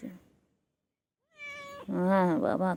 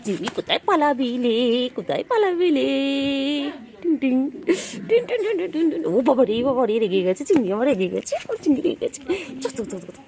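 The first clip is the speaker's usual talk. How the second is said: loud, high excited squeals at the pet; playful chase; 5 semitones higher, 6 dB louder than usual. Real, not acted. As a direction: chase the pet, calling out gleefully. (উত্তেজিত জোরালো খেলার ডাক)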